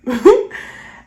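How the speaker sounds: rising laugh. Real, not acted. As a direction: laugh out, lifting up at the end. Laughs telling the story.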